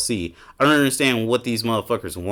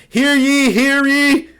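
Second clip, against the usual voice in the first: much higher, louder hammed-up olde English proclamation; mock medieval herald; 13 semitones higher, 6 dB louder than usual. Mocking Elden Ring fans with a much higher, louder hammed-up herald voice. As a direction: ham up a pompous fantasy crier. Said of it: "everybody wants these fucking Elden Ring ass".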